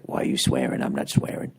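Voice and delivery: low, quiet, icy-calm menace; lightly voiced unflappable hard man; Ben Kingsley calm. Wife staying cool while husband flips out, low icy calm. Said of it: "be like Ben Kingsley in Sexy Beast".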